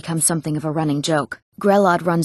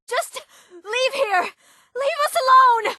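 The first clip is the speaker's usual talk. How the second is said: very high, drawn-out scream; desperate scream. Acted. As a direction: scream at them to go away. Desperate plea to be left alone.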